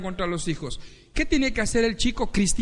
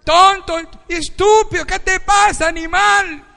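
much higher, much louder yelled insults; furious insults; 9 semitones higher, 11 dB louder than usual. Retold or imitated. Angry driver yelling at someone who cut him off, furious. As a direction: scream at the other driver.

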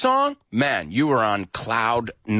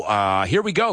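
normal talking voice

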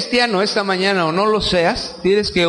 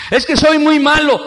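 mock excuse; 7 semitones higher, 5 dB louder than usual. Imita a alguien que se excusa.

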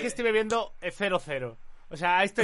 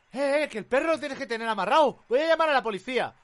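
higher, lightly voiced busybody telling off; nosy neighbour scolding; 6 semitones higher than usual. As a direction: mimic the neighbour's complaint.

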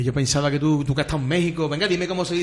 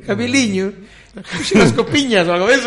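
mock Galician; 4 semitones higher, 6 dB louder than usual. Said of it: "invítame en gallego".

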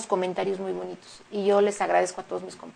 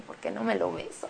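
soft, laughing aside, much quieter; joking denial; 10 dB quieter than usual. Real, not acted. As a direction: say it under your breath through a giggle. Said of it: "Ya ves, Valentín". (negación en broma, bajito y riendo)

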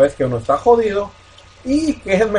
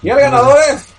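award show host; 7 semitones higher, 6 dB louder than usual. Pretend. Parodia de ceremonia de premios.

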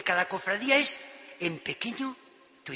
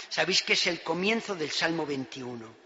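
ordinary talk, nothing marked

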